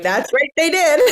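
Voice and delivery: high, loud, playful boast; mock-proud reply. Mother answering her young daughter about the building, mock-proud. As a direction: play along proudly, about to burst out laughing.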